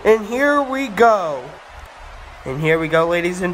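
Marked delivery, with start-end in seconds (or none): 0.1-1.4 higher-pitched match kickoff call; excited announcer. Pretend. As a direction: build anticipation, stretching the last words. Commentator announcing start of match.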